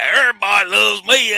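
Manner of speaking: high, loud, smug mimicry of Joey; mock arrogant Joey. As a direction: show off like a vain wrestler. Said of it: "He's arrogant".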